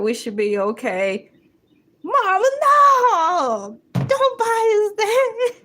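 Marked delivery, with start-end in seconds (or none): 2.0-5.5 high, loud, slow crying plea; pleading kid. Pretend. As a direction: wail like a kid begging mom. Kids pleading with mom.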